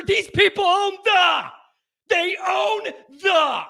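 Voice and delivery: shouting rant. Real, not acted. Radio host shouting at Ohio State fans in a furious rant. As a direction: yell it at the rival fans, fed up.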